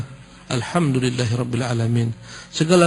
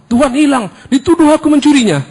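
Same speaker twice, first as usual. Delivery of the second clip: loud, high panicked cry; panicked thief; 12 semitones higher, 8 dB louder than usual. Retold or imitated. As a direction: blurt it out in terror. Frightened thief tells brother. (pencuri panik berteriak kepada abangnya)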